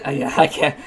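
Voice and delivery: ordinary talk, nothing marked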